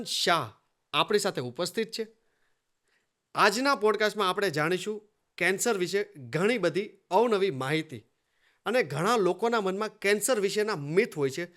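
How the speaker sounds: measured presenter delivery with lifted phrase openings and deliberate pauses; formal show-opening announcement; podcast host introduction. Acted. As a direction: welcome the listeners formally, phrase by phrase.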